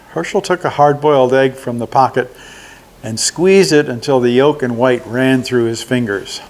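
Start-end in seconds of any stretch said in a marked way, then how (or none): none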